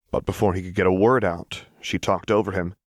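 plain reading voice

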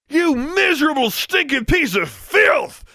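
high, furious strained shout; bellowed. A narrator's reading. Rescuer kicks the bully, bellowing in rage. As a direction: bellow abuse, stretching the final words. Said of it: "Maximilian bellowed".

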